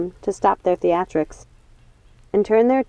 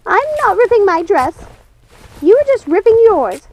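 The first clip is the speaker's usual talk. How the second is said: high, louder indignant denial; wild Martha; 13 semitones higher, 7 dB louder than usual. Acted. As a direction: snap back, a bit wild. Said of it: "her eyes wild".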